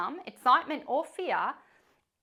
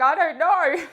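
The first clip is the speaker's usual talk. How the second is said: laughing; 6 semitones higher, 12 dB louder than usual. Retold or imitated. Daughter's answer, laughing.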